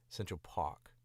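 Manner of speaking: "Central Park" in New York style, "Park" as "Pahk". Shown as a demonstration. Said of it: "R to sound like an H".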